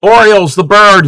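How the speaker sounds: ordinary talk, nothing marked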